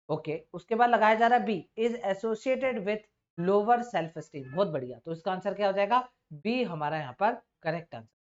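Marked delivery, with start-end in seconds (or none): 1.8-4.4 slow reading aloud. Shown as a demonstration. Teacher reads option aloud.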